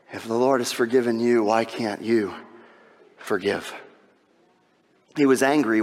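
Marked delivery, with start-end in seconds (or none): none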